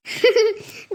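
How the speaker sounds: short teasing laugh; brief acted giggle; amused laugh. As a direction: laugh at an absurd claim. Sister-in-law teases about afternoon breakfast.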